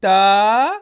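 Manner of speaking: ता called out loud on its own; sounding out. Shown as a demonstration. Teacher sounds out the letter for students. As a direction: call out ता loudly. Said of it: "गा में औ की मात्रा ग".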